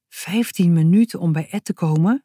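usual speaking voice

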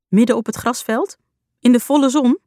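can't believe what she's hearing; mother questioning Midden op het grasveld in disbelief; incredulous. Acted.

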